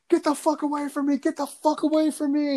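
high, frantic yell of a terrified worker; shrieking get away; retold panicked scream. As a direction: scream it like you're running from a ghost. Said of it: "Just screaming".

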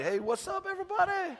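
high, soft, breezy greeting; casual mock Lazarus. Preacher joking that Lazarus strolled out, in a breezy high voice. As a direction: play it laid-back and silly. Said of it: "come strolling out, like".

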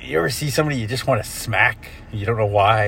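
ordinary delivery

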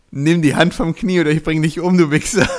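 loud, furious threat; angry quote. Retold or imitated. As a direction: bark the threat at him. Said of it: "Originalzitat von Mario ist".

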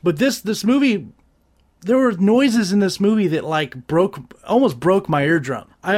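ordinary talk, nothing marked

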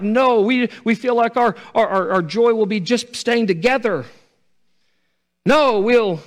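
high, loud crying plea; pleading daughters-in-law. Acted. Daughters-in-law begging Naomi to let them stay, loud plea.

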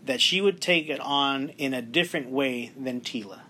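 usual speaking voice

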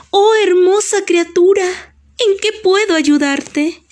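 high, lilting fairy voice; hada. Acted. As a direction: greet the creature as a gracious fairy, pitched high. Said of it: "una hada hermosa y muy brillante". (voz aguda de hada)

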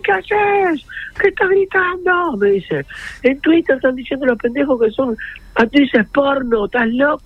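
very high, incredulous retold outburst; mock scolding. Colleague finding out what he has been shouting, mock scolding. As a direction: act out an alarmed telling-off, voice way up.

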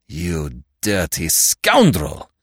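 slow, bitter insult under the breath; angry mutter. A narrator's reading. Inspector cursing the thief who tricked him, slow seething mutter. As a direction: spit it out slowly, seething. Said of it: "he muttered".

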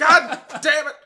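loud, high laughing curse; mock exasperated yell. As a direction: shout it in mock fury. Topping the joke.

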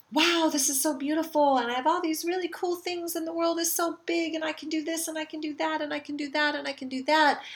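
high-pitched run-on wonder, hammed up; gushing excitement. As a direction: gush it all out as possibilities pile up. Acts out excitement at expansion.

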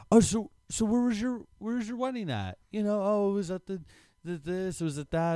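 high, mocking imitation of a shopper. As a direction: raise the pitch and mock them. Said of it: "Who says, like".

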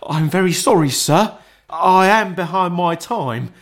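a frightened clerk begging pardon; timid clerk. A narrator's reading. Bob the clerk apologising.

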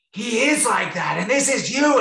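high, loud mock yell of an angry stranger; retold angry yelling. Angry couple yelling at dog owner, high loud retold shout. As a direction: throw it back at her like the furious couple did. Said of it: "screaming at me, like, so angry".

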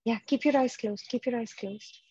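usual speaking voice

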